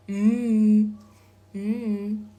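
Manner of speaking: M as a long hum, twice; isolated consonant sound. Shown as a demonstration. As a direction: hum M loudly, then repeat m. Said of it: "I am going to pronounce".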